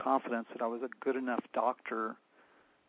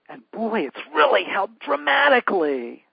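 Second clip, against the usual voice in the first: much higher and louder, bursting with delight; excited outburst; 7 semitones higher, 12 dB louder than usual. Retold or imitated. Patient bursting out to her doctor that the food helped, excited and loud. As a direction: let the good news burst out.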